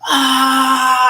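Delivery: high, loud, slow shrieking teacher; hammed-up outraged yell with a cry; mock angry yell. Teacher yelling at the caller, hammed-up high shriek. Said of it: "she yells at me".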